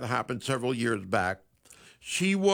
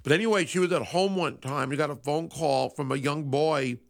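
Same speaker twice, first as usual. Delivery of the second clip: ordinary talk, nothing marked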